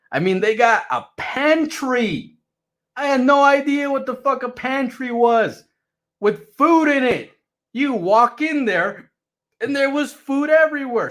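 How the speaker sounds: high, loud, astonished telling of the pantry; amazed. Real, not acted. Amazed at the house.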